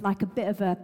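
ordinary talk

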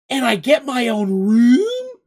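high, loud, drawn-out question; amazed delight. A narrator's reading. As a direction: stretch it out in disbelieving excitement. Amazed gully offered a room.

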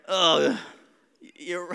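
ordinary talk

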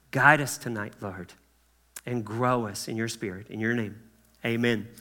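soft, low closing prayer. Real, not acted. Pastor thanking Jesus before teaching, soft reverent prayer. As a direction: pray gently, unhurried, with pauses.

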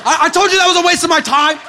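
high, loud protest; exasperated complaint. Acted. Rejected preacher scolding heaven, exasperated. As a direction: yell an I-told-you-so upward.

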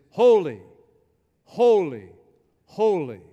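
loud, spaced-out proclamation, each word falling; angels' proclamation. Acted. Preacher voices the angels in heaven, loud repeated proclamation. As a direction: proclaim each word loudly, pausing between. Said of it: "they say over and over again is".

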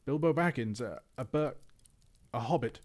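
terrified stammer. Acted. Bilbo answers the trolls, stammering in terror. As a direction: blurt it out shaking with fear. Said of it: "shaking all over".

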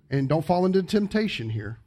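normal talking voice

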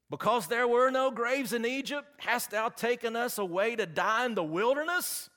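high-pitched full-volume accusing yell; crying out. Acted. Frightened Israelites cry out at Moses in the wilderness, loud protest. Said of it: "the children of Israel cried out".

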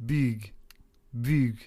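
"Big" said as "beeg". Shown as a demonstration. Player practicing the vowel rule, "Big" as "beeg". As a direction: stretch "Big" into a Russian "beeg". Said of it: "substituted it with E".

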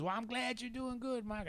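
high-pitched imitated old man; put-on voice of an older Southern stranger; Bay. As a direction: wish a friend well in an old-timer's voice. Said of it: "he goes".

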